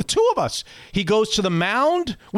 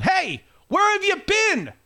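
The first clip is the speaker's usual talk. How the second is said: yelling; 13 semitones higher than usual. Retold or imitated. Calling a stranger over the fence, high yell. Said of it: "And I start yelling".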